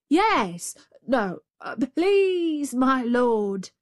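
hammed-up timid confusion; flustered jackal. A narrator's reading. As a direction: stammer meekly to the tiger.

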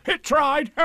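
very high, insistent tiger voice; Tigger. A narrator's reading. Tigger insisting to Pooh about the tablecloth, very high. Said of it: "said Tigger".

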